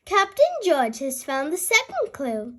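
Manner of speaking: ordinary delivery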